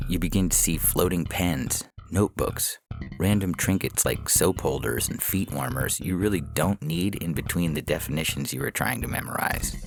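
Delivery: ordinary delivery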